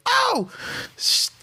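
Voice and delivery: high, put-on voice of a stoned crackhead; crackhead line. Quoting the crackhead role from Friday, high put-on voice. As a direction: squeak the line out like the movie crackhead.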